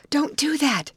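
usual speaking voice

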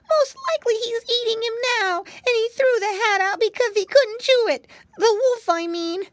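high, rushing piglet voice; a frightened little pig spilling it out; little pig Flop, alarmed. Acted. Flop explains the wolf.

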